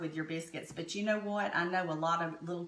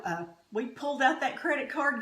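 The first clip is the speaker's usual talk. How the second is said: normal talking voice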